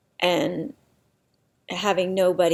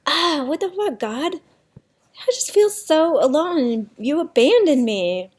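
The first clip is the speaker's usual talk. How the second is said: high, louder cry of anger at God; 8 semitones higher, 4 dB louder than usual. Retold or imitated. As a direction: let the anger at God break out, higher and louder. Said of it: "anger at just being all alone".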